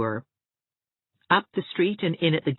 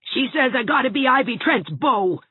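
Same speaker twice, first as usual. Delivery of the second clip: a hint of a sulky snarling boy; lightly voiced cross schoolboy; 10 semitones higher, 4 dB louder than usual. A narrator's reading. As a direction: growl it out resentfully. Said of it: "snarled Gerald".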